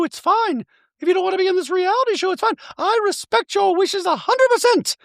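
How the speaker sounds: high, prim voice for Dorit; Dorit impression. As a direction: play Dorit sweetly apologetic in a high voice. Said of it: "And Dorit goes".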